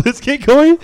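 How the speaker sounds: high, loud burst of excitement; excited shout. Real, not acted. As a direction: yell it out, thrilled. Excited idea, laughing.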